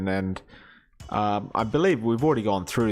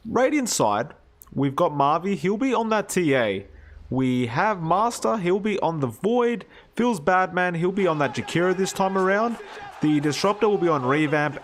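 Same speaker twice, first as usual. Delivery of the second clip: higher-pitched line-up announcement; esports announcer; 7 semitones higher than usual. Real, not acted. Caster introducing the Radiant draft, announcer voice. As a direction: announce each player with a swooping, punchy lift.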